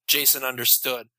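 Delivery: ordinary delivery, nothing marked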